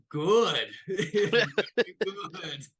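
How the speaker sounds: hearty laugh around the word; laughing approval. Acted. Kong pleased by crew's skills.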